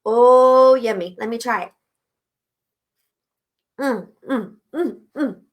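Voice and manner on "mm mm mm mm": soft pleased hum while tasting; savoring mm. Real, not acted. Tasting her smoothie on camera, pleased humming.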